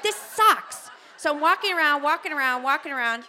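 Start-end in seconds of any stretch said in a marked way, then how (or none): 0.0-0.9 high, pained grumble; mock whiny complaint. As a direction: whine it like labor is unbearable. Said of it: "I'm like".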